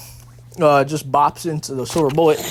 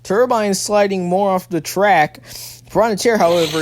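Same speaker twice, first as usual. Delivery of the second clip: ordinary delivery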